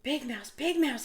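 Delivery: high mouse voice calling. Acted. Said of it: "he called".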